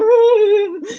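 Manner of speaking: high-pitched comic wail; mock crying. Child stuck in clay after the rope swing, mock crying. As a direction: imitate a child bawling, hammed up. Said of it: "just crying".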